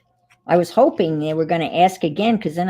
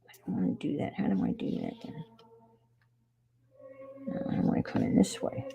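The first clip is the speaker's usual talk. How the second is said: whispering; 10 dB quieter, faster than usual. Real, not acted. Talking to herself while crafting.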